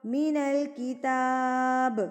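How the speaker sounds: slow, melodic tajweed chant; slow measured recitation of the verse; Quran recitation. Acted. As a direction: recite each word drawn out.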